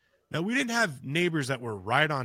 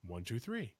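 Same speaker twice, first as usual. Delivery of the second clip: much softer, like an answer from far away; faint echo; 2 semitones lower, 10 dB quieter than usual. Retold or imitated. Imitating the thing in the woods answering his knocks, much softer. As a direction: count it back faint, as if from the woods.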